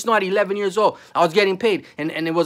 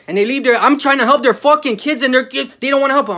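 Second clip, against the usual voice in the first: loud, high angry yelling; loud high yelling at parents who don't help; angry shout; 7 semitones higher, 7 dB louder than usual. Real, not acted. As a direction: yell it in real anger. Angry rant about parents.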